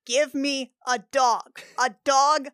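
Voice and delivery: ordinary delivery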